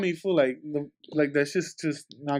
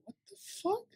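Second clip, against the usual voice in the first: hushed shocked; 14 semitones higher, 13 dB quieter than usual. Retold or imitated. Retold shock at crying roommate.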